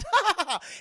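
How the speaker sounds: high, loud hammed-up evil laugh; villain cackle. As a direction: cackle like a cartoon baddie. Villain laugh in retold scene.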